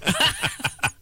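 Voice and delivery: high giggle; high laugh. Real, not acted. Laughs at herself.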